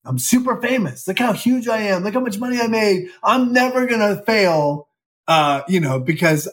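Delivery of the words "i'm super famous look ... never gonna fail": high, boastful self-mockery; cocky younger self. Actor mocking himself after his big movie, cocky. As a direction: imitate your own swelled head, pitched up. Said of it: "and I'm like".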